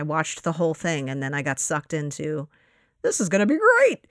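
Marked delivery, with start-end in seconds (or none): 3.0-4.1 high, loud burst of retold hype; excited. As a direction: imitate getting carried away, pitched way up. Retells getting sucked into trailer.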